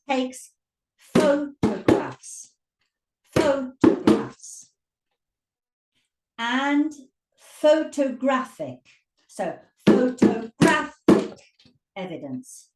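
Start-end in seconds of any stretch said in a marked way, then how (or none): 1.0-4.7 slow, loud PHO-to-graphs; stress on first syllable. Shown as a demonstration. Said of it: "see where the stress changes". 7.4-11.4 slow pho-to-GRAPH-ic, GRAPH punched; loud, split photoGRAPHic; stress on third syllable. Shown as a demonstration. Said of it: "Can you see where the stress changes?".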